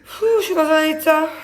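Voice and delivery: worn out from exercise; after a workout. Real, not acted. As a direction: spent after the gym. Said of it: "Je sors du sport".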